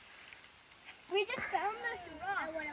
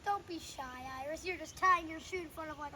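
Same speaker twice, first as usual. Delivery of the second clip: ordinary delivery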